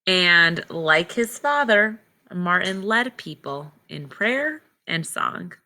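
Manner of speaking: clear, measured read-aloud storybook voice; reading to children. Acted. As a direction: read the book to the class slowly. Teacher reads picture book aloud.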